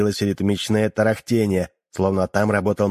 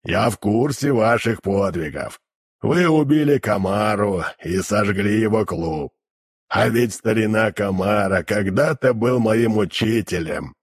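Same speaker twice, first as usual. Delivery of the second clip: demon voice. Acted. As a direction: drone it like a hellish creature. Demon keeps his voice.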